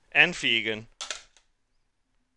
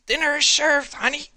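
calling out. Retold or imitated. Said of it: "I had to call my girlfriend, like".

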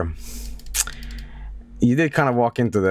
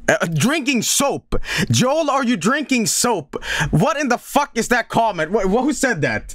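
shocked disbelief; 9 semitones higher than usual. Real, not acted. Reading a shocking chat comment.

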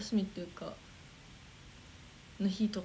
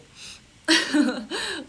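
teasing laugh; 8 semitones higher, 9 dB louder than usual. Real, not acted. Teasing about unreleased photo.

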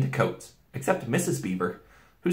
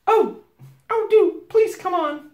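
put-on very high, loud little-girl plea; pleading Lucy; 8 dB louder, slower than usual. A narrator's reading. Small girl begging them to hurry, high and pleading. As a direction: beg in a high urgent voice.

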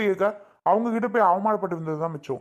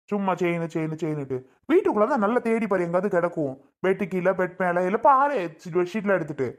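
usual speaking voice

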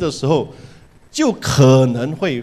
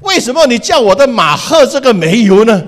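a hammed-up angry neighbor, louder and higher; mock anger; 5 semitones higher, 6 dB louder than usual. Man blaming his neighbor for the dead horse, mock angry. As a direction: accuse him, half-playful fury. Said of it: "立刻就跑过去".

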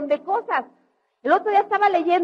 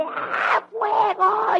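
gasp, then a high shouted "fuego!"; a sudden yell from someone watching flames; panicked cry of fire; 8 semitones higher, much slower than usual. Retold or imitated. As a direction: sound the alarm like a frightened crowd. Crowd yelling during fire.

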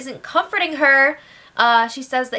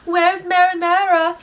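mimicking Tim; 7 semitones higher, 3 dB louder than usual. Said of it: "so he's just like".

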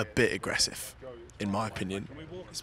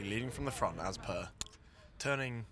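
normal talking voice

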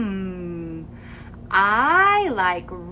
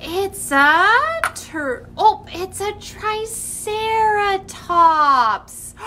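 excited discovery; 9 semitones higher, 4 dB louder, slower than usual. Pretend. Mock surprise at the hatching egg.

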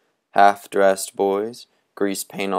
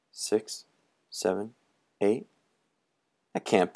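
hushed unvoiced count; whispered counting; 8 dB quieter than usual. A narrator's reading. Counting in hush.

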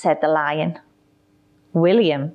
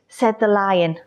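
ordinary delivery

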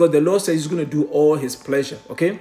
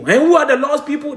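raised, louder pulpit voice; fiery preaching; 11 semitones higher, 7 dB louder than usual. Acted. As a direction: preach it hard to the congregation. Preaching at full force.

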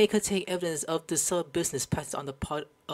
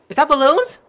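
surprised question; 8 semitones higher, 9 dB louder than usual. Real, not acted. Streamer spots balloons.

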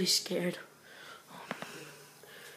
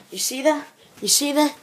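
urgent; 7 semitones higher, 7 dB louder than usual. Real, not acted. Urgently pointing out something.

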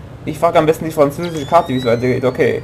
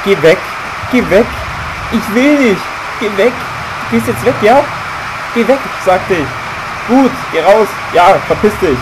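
shooing yell; 6 semitones higher than usual. Pretend. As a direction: yell at them to get lost. Shooing NPCs away, yelling. (laut verscheuchend)

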